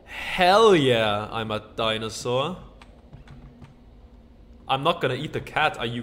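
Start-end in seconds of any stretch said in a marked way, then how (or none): 0.1-1.3 loud delighted hell yeah; excited cheer. Real, not acted. Gamer thrilled at being a dinosaur, loud cheer. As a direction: cheer the good news.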